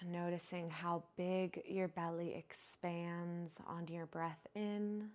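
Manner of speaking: unhurried, even-toned guide into relaxation; guided meditation. Acted. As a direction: ease them into stillness, drawing out the phrase endings. Leads breathing and body relaxation.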